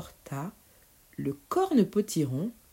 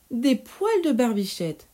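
put-on mischievous boy; 6 semitones higher, 4 dB louder than usual. Pierre teasing the witch.

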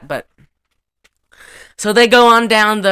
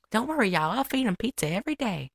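higher, softer mock country gal; 4 semitones higher, 10 dB quieter, faster than usual. Reassuring his family about pizza, soft imitation of country gal. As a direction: lilt it lightly, soft and high. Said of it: "Dolly says something".

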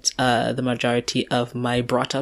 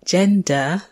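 put-on British accent, rising and higher; higher-pitched British accent, lilting upward; British person impression; 4 semitones higher, 3 dB louder than usual. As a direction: say "Gender?" as an exaggerated British questioner. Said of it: "your best impression of a British person".

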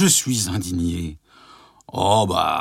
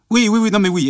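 flustered burst; 11 semitones higher, 5 dB louder, faster than usual. Acted. Flustered hurried agreement.

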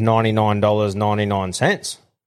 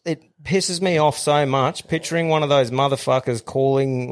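usual speaking voice

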